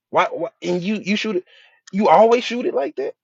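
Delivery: high-pitched, rising mock interrogation; pressing questioning. Coach grilling a player about their shot, high and incredulous. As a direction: keep pressing, pitch climbing to a loud finish. Acting out pointless questioning of a shooter.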